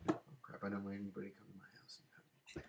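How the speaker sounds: hushed, fast mock panic whisper. Himself reacting to angry comments, hushed fast mock fright. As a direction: gabble it like a kid who got caught. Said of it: "and I'll go".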